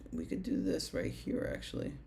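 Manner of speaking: whispered aside. Real, not acted. Muttering over chart setup.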